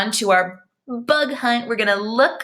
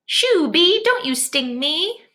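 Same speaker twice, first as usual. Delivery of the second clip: shooing a bee; 6 semitones higher, slower than usual. Pretend. Teacher pretending to shoo a bee from her, playful.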